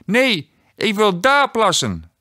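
mock stubborn child; stubborn toddler. A narrator's reading. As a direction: dig in like a defiant three-year-old. Toddler refuses.

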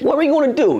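high-pitched alarmed question; comic high-pitched incredulity; alarmed disbelief. Retold or imitated. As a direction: ask it in mock panic, voice jumping way up. Alarmed reply to retold threat.

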